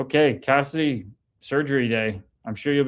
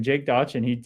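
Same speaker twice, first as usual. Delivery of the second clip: normal talking voice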